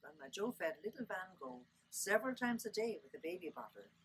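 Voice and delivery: usual speaking voice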